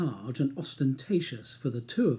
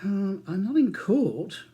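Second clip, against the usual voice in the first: hesitant and slow, pitched a little up; tentative; 6 semitones higher than usual. A narrator's reading. Charles unsure about taking an unpaid day, tentative and slow. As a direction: feel your way into the answer, unsure. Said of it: "replies Charles tentatively".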